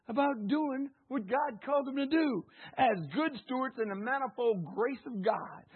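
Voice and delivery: ordinary talk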